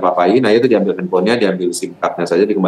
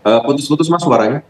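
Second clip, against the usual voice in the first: raised voice over bad line; 8 semitones higher than usual. Real, not acted. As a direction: call out to be heard on the phone. Bad phone line, calling out. (suara dinaikkan karena sambungan putus)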